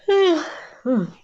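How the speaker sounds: loud open-mouthed yawn; big yawn. Real, not acted. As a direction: let out a long yawn. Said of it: "I just have to yawn".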